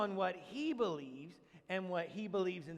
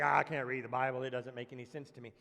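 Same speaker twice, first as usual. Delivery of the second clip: lower, deflated mock complaint; mock complainer; 10 semitones lower than usual. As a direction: play the discouraged reader, lightly mocking. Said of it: "some of you are like".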